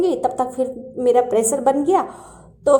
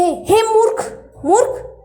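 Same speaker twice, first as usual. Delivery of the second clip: much higher, sharp mock rebuke; angry scolding; 9 semitones higher, slower than usual. Krishna angrily calling the sleeping devotee a fool. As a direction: scorn the late sleeper. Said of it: "बस गुस्से में वो ये ऐसे ही कहते थे".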